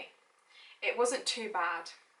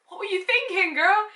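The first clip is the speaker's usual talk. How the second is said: high, loud sassy call-out; higher and louder than the first take, playful; sassy tease; 8 semitones higher, 5 dB louder than usual. Pretend. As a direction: tease your younger self, full sass. Repeats the teasing line louder.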